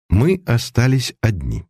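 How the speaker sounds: ordinary delivery, nothing marked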